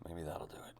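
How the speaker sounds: whispered to himself; whispering. Real, not acted. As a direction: whisper it under breath. Adjusting camera.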